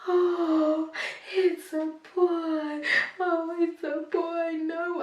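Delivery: put-on new mother stunned to hear it's a boy; stunned joy. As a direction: let the surprise stretch every word. Told the baby is a boy.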